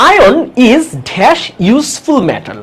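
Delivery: reading the exam item very slowly; slow question read. Shown as a demonstration. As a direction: spell the question out word by word. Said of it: "এটা আর্টিকেলের একটা প্রশ্ন".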